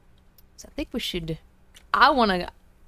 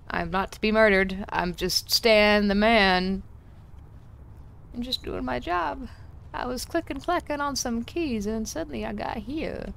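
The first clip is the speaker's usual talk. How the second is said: put-on playful southern accent, higher toward the end; 3 semitones higher than usual. Clicking away at the game keys, southern accent. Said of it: "I gave my southern accent just then".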